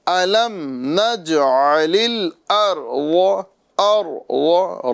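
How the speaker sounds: الارض recited slowly with a thick, full ر; slow tajweed الارض, full-mouthed ra; full heavy ra. Shown as a demonstration. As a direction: draw out الارض, making the ر heavy. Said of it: "را کو پر کریں گے".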